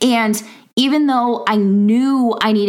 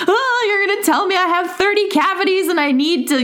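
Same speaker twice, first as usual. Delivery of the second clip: high, fretful mock-panic; retelling her panic to the hygienist, pitched up; anxious self quote; 9 semitones higher than usual. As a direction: squeak out the worst-case fear. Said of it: "And I was like".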